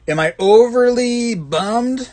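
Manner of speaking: mock dramatic. Reads viewer question mockingly.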